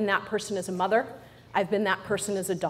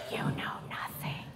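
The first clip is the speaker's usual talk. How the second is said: breathy stage whisper, slow; 12 dB quieter than usual. Acted. Speaker confides to the audience in a slow whisper. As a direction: let it drop to a secret.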